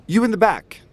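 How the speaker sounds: pitched well up, calling to someone; single out a listener from the crowd. Acted. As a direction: orator hailing the back of the room. Agitator calls out to listener.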